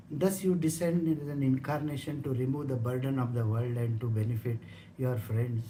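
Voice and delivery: low, soft, measured scripture reading; verse reading. Acted. Teacher reading scripture to the satsang, low and measured.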